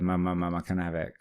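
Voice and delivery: ordinary delivery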